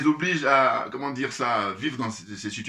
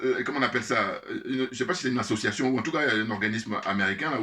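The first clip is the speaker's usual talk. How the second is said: usual speaking voice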